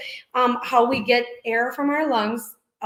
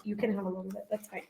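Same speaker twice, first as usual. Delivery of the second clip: quiet aside; 2 semitones lower, 13 dB quieter, much faster than usual. Real, not acted. Aside to a child asking for something.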